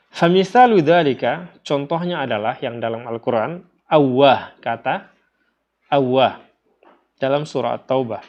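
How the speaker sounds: usual speaking voice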